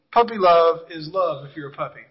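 ordinary delivery

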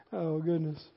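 ordinary talk, nothing marked

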